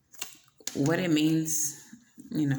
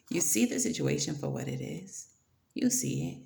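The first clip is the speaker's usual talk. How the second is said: ordinary talk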